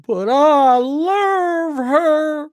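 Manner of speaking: high, drawn-out lovesick Southern whine; hammed-up Southern drawl, very slow and high; mock Southern accent. Host mimicking the caller who caught feels, mock Southern. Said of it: "I don't know why he's Southern all of a sudden".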